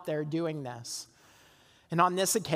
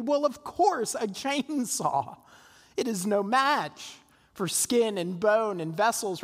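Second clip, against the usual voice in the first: high, slow, laughing mock certainty; mock confident; 3 semitones higher, slower than usual. Pastor mocks his old confidence about the chainsaw, high and slow with a laugh. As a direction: play your own naive self-assurance with a chuckle. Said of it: "I would have told you intellectually".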